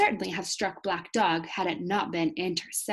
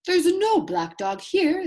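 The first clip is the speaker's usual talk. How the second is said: high, loud, slow insistence; doctor's loud, slow, firm denial; 11 semitones higher, 7 dB louder, slower than usual. Acted. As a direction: insist firmly, drawing it out. Doctor firmly corrects the captain.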